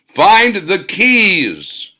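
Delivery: slow, loud and high furious roar. Acted. Ra roaring his order at Hans, slow furious bellow. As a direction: roar it like an angry god. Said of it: "Ra roared".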